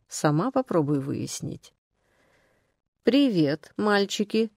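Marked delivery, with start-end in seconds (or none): none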